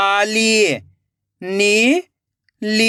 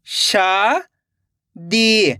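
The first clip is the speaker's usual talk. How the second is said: slow blended reading; much slower than usual. Shown as a demonstration. Teacher showing pupils how शादी blends, drawn slowly. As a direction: drag शादी out very slowly, the ई long. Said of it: "एक बार फिर से देखिए".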